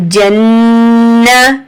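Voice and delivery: जन्ना slow, nn held nasal for two seconds; held nasal ghunna. Shown as a demonstration. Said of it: "टू second आप गुन्ना करेंगे".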